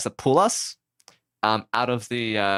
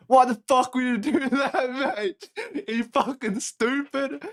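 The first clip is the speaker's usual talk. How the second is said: high, hammed-up Aussie, laughing through it; mock-scolding in a broad Aussie accent; 11 semitones higher than usual. Rescuer mocking stranded off-roaders, laughing broad Aussie accent. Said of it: "broad Aussie accent".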